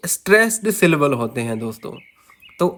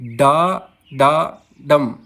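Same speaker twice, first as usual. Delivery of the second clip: very slow, lower डा डा डम, first डा stressed; 4 semitones lower, much slower than usual. Shown as a demonstration. Teacher repeating the dactyl, slow stress on first डा. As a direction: separate each syllable, weighting the first डा. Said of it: "पहला दोस्तों stressed होता है".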